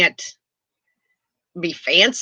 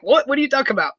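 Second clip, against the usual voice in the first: higher-pitched, imitated puzzled reaction; mock bafflement; 7 semitones higher, much faster than usual. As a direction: act out being taken aback, lightly. Acts out her puzzled reaction.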